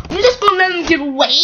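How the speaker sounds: fast, loud, high cross question. Acted. Demanding someone stop the runaways, crossly. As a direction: fire the question off indignantly. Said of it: "he said crossly".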